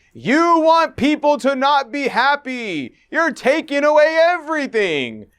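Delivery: high, drawn-out wailing protest; mock screaming. Narrator mimicking the woman who ended the debate, mock screaming. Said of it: "The women ended by screaming".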